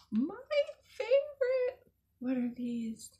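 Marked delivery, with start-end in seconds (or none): 0.0-1.9 gasp, then squeal the word much higher; thrilled at the picture, voice leaping up; delighted gasp. Real, not acted. Gasps at a bunny picture.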